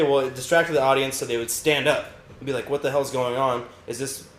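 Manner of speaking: ordinary talk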